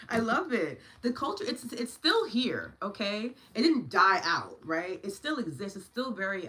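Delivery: high and loud, driving the point home; passionate emphasis. Real, not acted. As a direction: preach it with fire.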